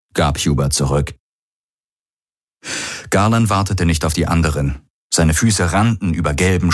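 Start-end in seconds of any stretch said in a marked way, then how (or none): none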